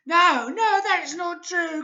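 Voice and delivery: put-on high, squeaky worried old woman; crying Missus Twit. A narrator's reading. Frightened wife crying out, high and squeaky. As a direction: cry out in alarm like an old hag. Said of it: "cried Missus Twit".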